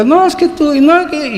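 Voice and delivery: high-pitched mock protest; acted family bickering. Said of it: "y empieza el tira, tira, tira".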